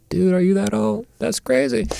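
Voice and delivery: high, soft mock amazement; retold amazement. Own reaction in retold chat.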